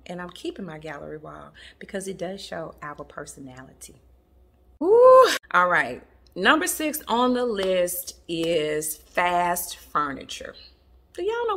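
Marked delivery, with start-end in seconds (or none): 4.8-5.3 loud high-pitched "woo"; high, loud whoop. Real, not acted. Vlogger moving on to next trend, loud high whoop. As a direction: let out a sharp, high whoop.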